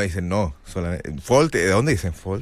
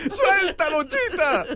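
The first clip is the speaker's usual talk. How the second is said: high, strained comic cry; pained Tarzan plea; 4 dB quieter, much slower than usual. Acted. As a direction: beg the chimp to let go, high-pitched. Playing Tarzan yelling at Chita. (súplica aguda de Tarzán a Chita)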